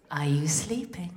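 hushed bedtime whisper; whispering a lullaby question to her daughter. Retold or imitated. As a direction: breathe it like she's nearly asleep. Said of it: "And I'll say to her".

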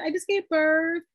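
high, singsong greeting; loopy self. Retold or imitated. Calling her ex-husband while high, singsong. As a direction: lilt it out, dazed on meds. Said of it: "I said".

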